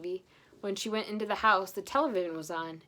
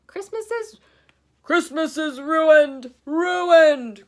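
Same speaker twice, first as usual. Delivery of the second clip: high, loud wailing cry, slow; despairing cry; 9 semitones higher, 15 dB louder, much slower than usual. Acted. Santa crying out that Christmas is ruined. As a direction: wail it as if all is lost. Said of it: "cried Santa".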